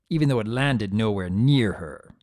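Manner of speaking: ordinary delivery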